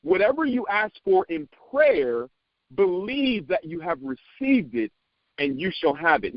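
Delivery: slow, high, reverent reading of the verse; preacher repeating the verse slowly for emphasis; solemn scripture reading again. Shown as a demonstration. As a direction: let each phrase hang in the air. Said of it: "I'm gonna say that again".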